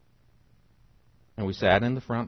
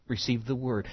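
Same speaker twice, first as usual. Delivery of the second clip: ordinary delivery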